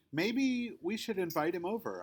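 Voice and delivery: eager suggestion. A narrator's reading. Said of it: "I say".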